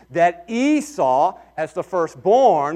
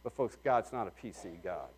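soft, low and fast; quiet confiding aside; 5 semitones lower, 8 dB quieter than usual. Real, not acted. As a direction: lower the voice like a confession. Drops voice for aside.

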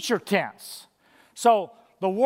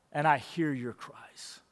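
softer and slower, gentle; tender reassurance; 7 semitones lower, 9 dB quieter, slower than usual. Acted. Preacher voicing God's comfort to the suffering, tender and soft. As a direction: speak it like comfort to someone hurting.

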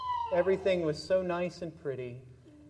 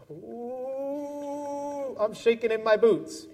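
mock scared; 8 semitones higher, much slower than usual. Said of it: "Woody's going".

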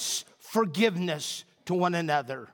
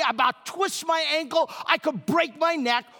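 ordinary talk

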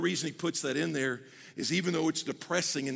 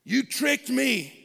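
slow, loud, higher accusation; accusing complaint; 5 semitones higher, 5 dB louder, much slower than usual. Acted. Disappointed believer addressing God, slowly accusing. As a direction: draw out the accusation against God. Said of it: "we say it like this".

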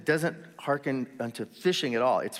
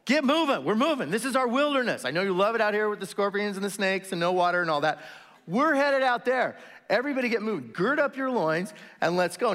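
high-pitched rallying call to a crowd; Moses; 6 semitones higher than usual. Acted. Leader to his people in the wilderness, rousing call. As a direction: rouse everyone to get marching. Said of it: "it's what Moses would say to him".

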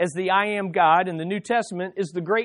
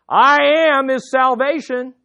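loud, raised call with a drawn-out I AM; 6 semitones higher, 7 dB louder, slower than usual. Acted. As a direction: cry it out slowly, higher and louder. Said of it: "you would cry out".

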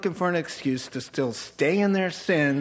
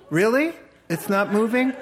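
higher, louder sceptical question; mock incredulous; 8 semitones higher, 4 dB louder than usual. Preacher challenging the congregation's everyday saying, mock incredulous. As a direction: challenge it with a rising, disbelieving question.

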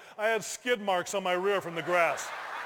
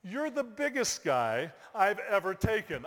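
slow, understated instructor voice; unhurried deadpan delivery; measured announcement. Retold or imitated. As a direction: deliver the bad news slowly, evenly. Instructor's news, then shouted repeat.

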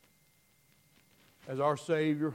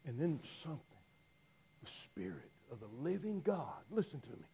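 hushed; 8 dB quieter than usual. Real, not acted. Preacher hushes for the Spirit.

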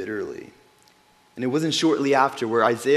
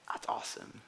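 awed whisper; 16 dB quieter than usual. Real, not acted. Awed reaction.

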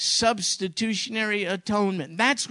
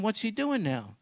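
ordinary talk, nothing marked

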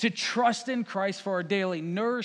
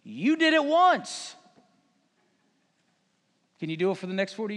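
demanding challenge; 4 dB louder than usual. Acted. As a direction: challenge them like a skeptical crowd, dragging out key words. Crowd challenging Jesus, acted.

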